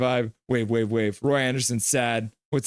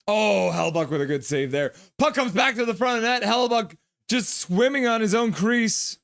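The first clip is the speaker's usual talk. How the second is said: higher, quick hockey call; excited play-by-play; 8 semitones higher than usual. Acted. Streamer calling the game, excited play-by-play. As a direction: call the scramble live.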